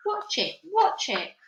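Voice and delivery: high urgent repeats. Acted. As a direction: press her to look. Urging her sister.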